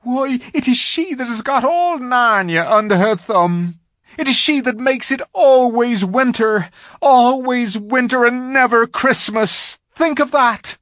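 loud, high, dramatic lament; wailing faun. A narrator's reading. Faun telling Lucy of the Witch's endless winter, wailing. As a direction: cry it out in despair.

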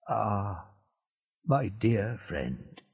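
put-on faint, slow voice of a dying old man; weak and quiet. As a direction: barely get the words out, fading. Dying old man speaks weakly.

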